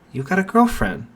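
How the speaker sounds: surprised question. Acted. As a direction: catch the news with a sudden high lift. Mitsuki's ears perk up.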